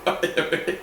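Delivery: high laugh, winding down slowly; trying to stop laughing. Real, not acted. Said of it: "God, I'm gonna stop".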